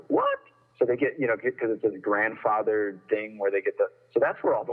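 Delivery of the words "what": sharp high disbelieving what; retold stunned what; incredulous what. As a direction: jump way up in pitch in shock. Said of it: "I'm like".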